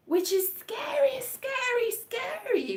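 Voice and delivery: high, slow spooky drawl on scary; spooky emphasis. Pretend. Telling about the scary film reveal, spooky. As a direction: draw out scary like a ghost story.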